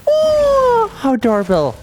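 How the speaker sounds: gasp, then a very high gushing coo; seeing something cute, gasping high and cooing; delighted gasp. Real, not acted. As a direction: melt at something adorable. Gasps at cute thing.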